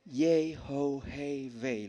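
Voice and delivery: "Yehovah" very slowly as yei-ho-hei-vei; breathy syllabic pronunciation. Shown as a demonstration. Preacher modelling for the congregation, "Yehovah" as slow yei-ho-hei-vei. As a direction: stretch each syllable of "Yehovah" on an audible breath. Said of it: "la pronunciación correcta es".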